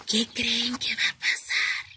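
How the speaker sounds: put-on hushed whisper to the children; suspense whisper. A narrator's reading. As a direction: whisper it, the bear is awake. Suspense after bear wakes.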